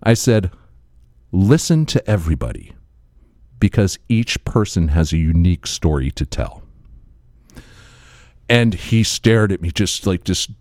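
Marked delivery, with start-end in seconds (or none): 1.3-6.6 low, measured mock-profound delivery; solemn wisdom. Storyteller offering philosophy to a drunk stranger, low and solemn. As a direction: hand down a maxim, low and grave.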